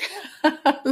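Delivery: a quick, high-pitched real giggle. Real, not acted. Reader giggling to herself after naming Whoopi Goldberg, high giggle. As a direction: let out a short giggle, up high. Reader laughs at her own thought.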